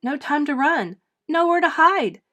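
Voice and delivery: loud, high panicked cry. A narrator's reading. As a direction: panic as the boots approach. Eric panicking.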